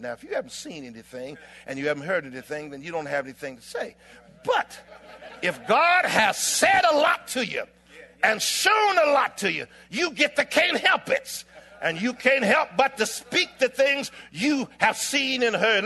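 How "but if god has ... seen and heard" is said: high, loud pulpit proclamation; impassioned sermon shout; full-force preaching. Real, not acted. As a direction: drive it home to the congregation.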